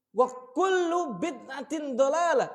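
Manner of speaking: loud, high, drawn-out Arabic recitation. Shown as a demonstration. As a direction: proclaim it as a stern warning, slowly and forcefully. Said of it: "pasti suaranya tinggi".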